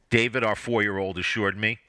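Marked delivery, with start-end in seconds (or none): none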